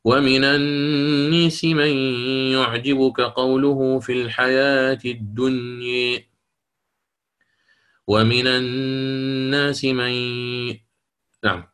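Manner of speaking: slow, melodic tajweed recitation; Quran recitation. Acted. Teacher recites verses to students in melodic chant. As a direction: draw out each phrase in chant.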